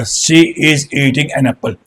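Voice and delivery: "she is eating an apple" drawn out word by word; slow example. Shown as a demonstration. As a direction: lay out each word slowly for the class. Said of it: "हर चीज अपने right place पे है". ("she is eating an apple" धीरे धीरे, शब्द दर शब्द)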